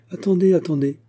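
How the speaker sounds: high, urgent repeated call to stop him; eager call. Acted. Lady stops him to see lace. (appel pressé et aigu)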